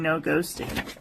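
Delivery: ordinary talk, nothing marked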